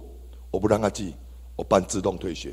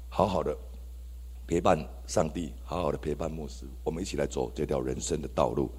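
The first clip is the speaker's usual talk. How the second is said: low and much softer exhortation; gentle appeal; 6 semitones lower, 9 dB quieter than usual. Real, not acted. Pastor's closing plea to his friends, soft low appeal. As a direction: draw them in softly and sincerely.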